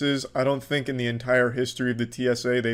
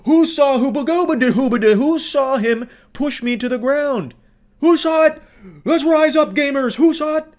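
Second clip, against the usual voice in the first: much higher, loud mock rallying shout; mock rallying cry; 12 semitones higher, 7 dB louder than usual. Commentator mocking the woman rallying the crowd. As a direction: whip up a crowd like a hysterical woman. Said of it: "rally the people behind her".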